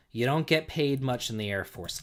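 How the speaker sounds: normal talking voice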